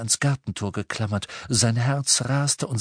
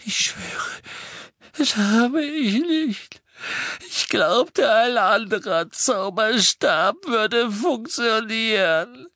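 high, loud, slow frightened voice; desperate pleading; 12 semitones higher, 4 dB louder, slower than usual. A narrator's reading. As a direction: plead for your life. Prisoner pleads under interrogation. (hohe, verzweifelte, flehende Stimme)

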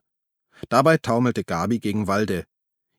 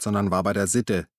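plain reading voice

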